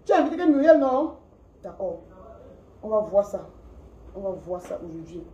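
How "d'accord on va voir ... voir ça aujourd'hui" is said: low, soft menace; quiet ominous warning; quiet threat. Real, not acted. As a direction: promise trouble under your breath.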